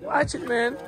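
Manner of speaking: loud, high, indignant ranting; angry complaint. Real, not acted. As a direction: shout out the grievance. Complaining, quoting the man.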